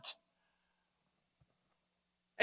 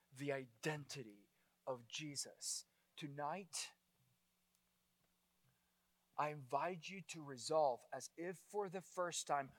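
much softer and lower, intimate; hushed appeal; 8 semitones lower, 12 dB quieter than usual. Real, not acted. Preacher quietly invites the congregation to believe, hushed. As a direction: draw them in with a quiet invitation.

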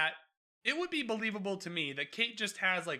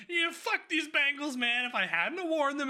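higher, hammed-up cursing fit; mock angry outburst; 8 semitones higher, 3 dB louder than usual. Podcaster voicing a character hurling bangles, mock furious outburst. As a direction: imitate someone blaming an object in a fury.

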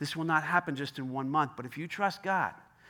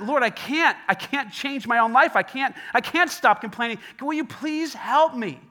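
desperate plea; 6 semitones higher, 8 dB louder than usual. Acted. Sinner pleading with God.

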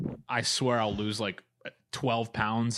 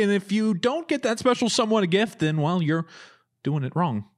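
lifestyle host voice; 6 semitones higher than usual. Pretend. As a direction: read it like a bubbly TV host. Lifestyle segment spoof read.

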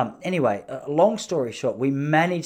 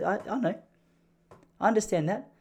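higher put-on voice for his wife; mock-innocent wife, pitched up; imitating wife; 7 semitones higher, 4 dB quieter than usual. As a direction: play her sweetly oblivious, in a higher voice. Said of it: "I don't know why she spoke like that".

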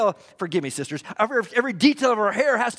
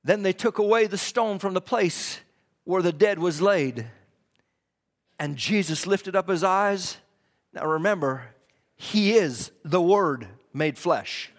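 low, softened voice with long pauses; hushed preaching; 6 semitones lower than usual. Real, not acted. Preacher reading scripture to the church, hushed and deliberate. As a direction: bring the sermon right down, measured and grave.

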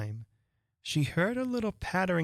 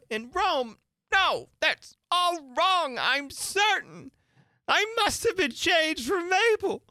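high, loud, slow anguished outburst; a girl crying out in alarm; panicked wail; distressed cry; 14 semitones higher, 5 dB louder than usual. Acted. Alice panics at her mistakes.

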